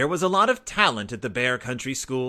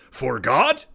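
louder, disbelieving roar building up; roaring father; 8 dB louder, much slower than usual. A narrator's reading. Papa roaring at the cubs about the forgotten notice. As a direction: bellow the word twice in disbelief. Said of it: "roared Papa".

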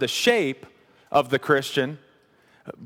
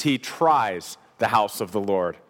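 "tries" stressed, short pause before a lower "the house of the Lord"; 7 semitones lower, slower than usual. Real, not acted. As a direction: lean on "tries", then let the rest drop away. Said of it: "I emphasize the word".

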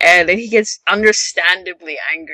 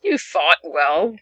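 slow mock consolation; 3 dB quieter, much slower than usual. Voicing the big guy.

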